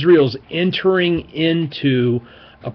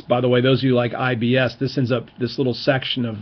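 normal talking voice

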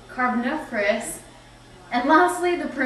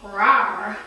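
dinosaur rawr; 2 semitones lower, 4 dB louder than usual. Acted. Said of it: "Danny the dinosaur".

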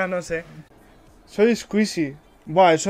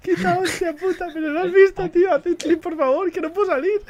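excited; 10 semitones higher, 5 dB louder than usual. Real, not acted. Laughing panic in game.

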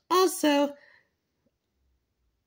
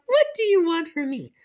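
ordinary delivery

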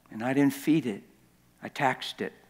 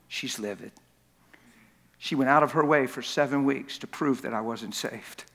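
normal talking voice

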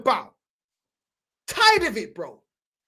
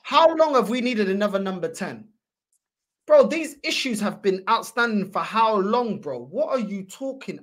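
ordinary talk, nothing marked

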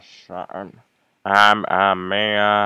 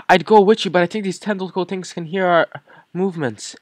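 much higher, fast anxious character voice; urgent NPC; 12 semitones higher, 7 dB louder, faster than usual. Acted. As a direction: warn the kid in a hurried high voice. Voicing an NPC warning the kid.

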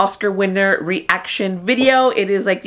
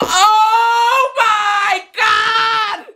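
shocked cry; 13 semitones higher, much slower than usual. Real, not acted. Vlogger crying out as her pick wins. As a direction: stretch it out, overwhelmed. Jamie Lee Curtis wins.

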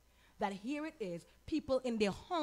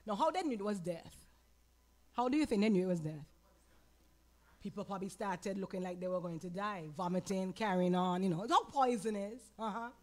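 ordinary delivery

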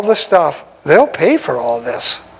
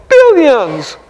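emphatic exclamation; 7 semitones higher, 3 dB louder than usual. Real, not acted.

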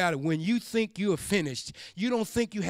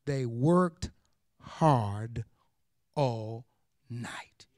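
they worked, hard, all, night, each word slow and apart, pitch low; stress every word; 10 semitones lower, much slower than usual. Shown as a demonstration. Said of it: "The text said".

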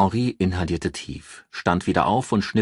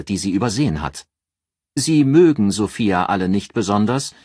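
higher, louder assured female voice; raised voice for a calculating friend giving advice; Ann, cool strategist; 4 semitones higher, 5 dB louder than usual. Acted. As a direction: lay out the plan like a cool strategist. Ann advising Henri.

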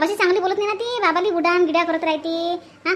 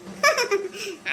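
short scornful laugh, softer; mocking laugh; 4 semitones higher, 4 dB quieter than usual. Daughter-in-law laughs scornfully at her sister-in-law.

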